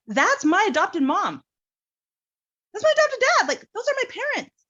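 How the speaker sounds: can't believe what he's seeing; stunned disbelief. Retold or imitated. Young man finding his adoptive parents in her baby book, stunned high voice.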